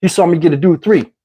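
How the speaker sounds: normal talking voice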